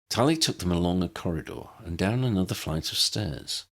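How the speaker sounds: plain reading voice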